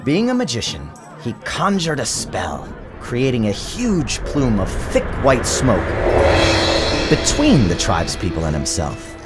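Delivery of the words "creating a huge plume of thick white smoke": ordinary delivery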